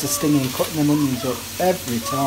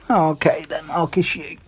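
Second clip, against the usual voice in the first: ordinary talk, nothing marked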